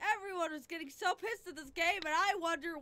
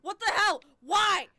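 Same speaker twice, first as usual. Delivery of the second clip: loud, higher yell at the game; frustrated yell; 6 semitones higher, 12 dB louder than usual. Real, not acted. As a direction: shout it out in disbelief. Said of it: "just by screaming at this game".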